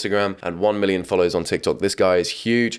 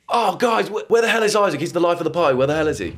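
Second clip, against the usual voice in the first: much higher worried friend voice; mock party friend; 9 semitones higher than usual. Friends at a party asking where Isaac went. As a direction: call out for the missing friend. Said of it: "if someone says".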